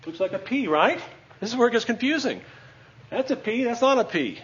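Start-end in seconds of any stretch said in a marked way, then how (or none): none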